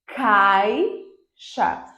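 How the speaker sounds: "caixa" split, long CAI, pause, then xa; "caixa" in two separated pieces, cai held long; syllable-by-syllable demo. Shown as a demonstration. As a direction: stretch the first syllable of "caixa" and detach the second. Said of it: "uma queda no meu som".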